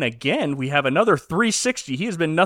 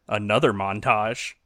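usual speaking voice

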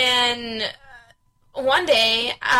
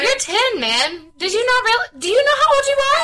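can't believe what she's hearing, voice climbing; incredulous; 12 semitones higher, 3 dB louder than usual. Real, not acted. Friend reacting to a scared ten-year-old, pitch climbing in disbelief. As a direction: pitch shooting up higher and louder in disbelief.

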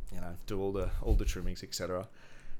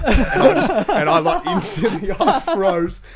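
loud laughing disbelief, high; 14 semitones higher, 14 dB louder, slower than usual. Real, not acted. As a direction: shriek it out through laughter. Reliving the scare.

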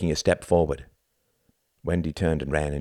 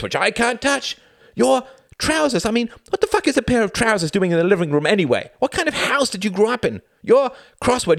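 angry rant; 5 dB louder than usual. Acted. Wendy ranting back.

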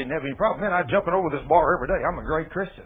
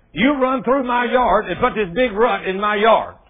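loud, slow, higher accusing yell; angry neighbor; 6 semitones higher, 8 dB louder, slower than usual. Retold or imitated. Angry man from the hangar yelling at the preacher. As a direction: bellow the complaint at him. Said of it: "He said".

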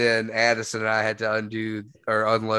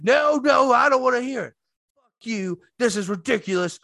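put-on angry old man; 10 semitones higher than usual. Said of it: "And I go".

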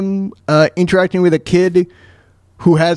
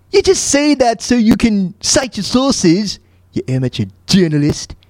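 Stewie; 6 semitones higher than usual. Acted. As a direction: needle the host in a high, affected voice. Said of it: "Well, Stewie".

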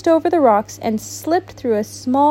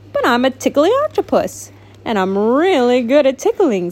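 ordinary delivery, nothing marked

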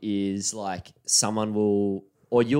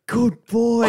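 high, loud, pleased praise to a machine; praising the TV; 11 semitones higher, 6 dB louder than usual. Retold or imitated. Friend finally gets the TV on, cheering it like a pet. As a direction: cheer the TV like a dog that obeyed. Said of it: "you just went".

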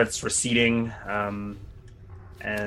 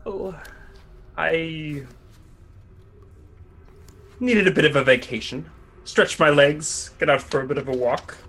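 sly rogue voice; 5 semitones higher than usual. Acted. Thief bluffing the captain in character.

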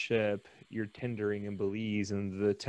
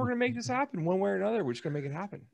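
much higher and louder, pumped party host; hype game guy; 6 dB louder, faster than usual. Acted. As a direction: fire up the crowd like a cruise director. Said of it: "I'm just freaking game guy".

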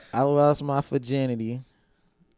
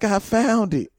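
put-on higher, sheepish kid voice; innocent excuse; 8 semitones higher, slower than usual. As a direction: play dumb to the teacher. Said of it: "I'm like".

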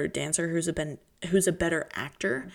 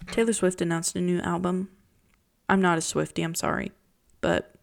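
normal talking voice

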